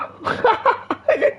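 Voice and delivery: squeaky high burst of laughter. Real, not acted. Laughing at his own gamer impression, high squeaky laugh. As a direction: crack up high.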